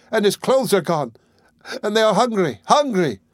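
put-on wife pointing to hungry children, despairing; despairing cry. A narrator's reading. Cry out for the hungry children. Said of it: "screamed in despair".

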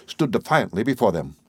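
plain reading voice

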